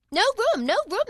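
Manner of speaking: high, loud cry. A narrator's reading. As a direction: shout them away, high and loud. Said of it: "they cried out".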